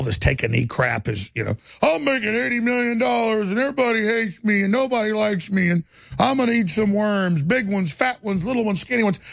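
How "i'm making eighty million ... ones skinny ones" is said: mocking whine. Mocking the kneeling football player.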